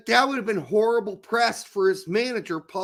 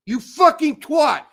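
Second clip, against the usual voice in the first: higher and louder repeat of the insult; louder angry insult; 6 semitones higher, 7 dB louder than usual. Real, not acted. Repeating the insult at the penalty taker, louder and higher. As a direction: hit it harder the second time.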